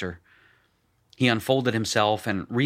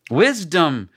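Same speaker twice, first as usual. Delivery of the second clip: loud, high exclaim; shout; 7 semitones higher, 8 dB louder than usual. A narrator's reading. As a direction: call it out forcefully. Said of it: "shouted Shirkahel".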